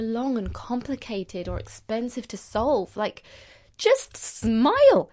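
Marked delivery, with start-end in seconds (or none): 3.8-5.1 drawn-out cheerful. Shown as a demonstration. Urging listeners to smile.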